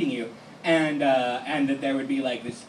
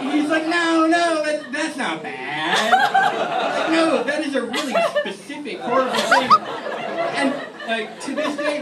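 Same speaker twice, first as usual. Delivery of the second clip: words broken up by laughter, pitch up and louder; retelling the group's horror while laughing; laughing through it; 11 semitones higher, 5 dB louder, slower than usual. Real, not acted. The teller laughing hard at the group's shock.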